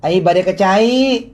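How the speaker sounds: put-on Sundanese accent. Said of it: "jawab dengan dialek dia".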